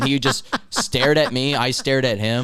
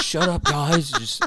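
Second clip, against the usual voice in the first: high, laughing mock whine of the boss; mock manager, higher and laughing; whiny manager; 6 semitones higher, slower than usual. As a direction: play the feeble manager pleading. Mimicking manager's weak protest.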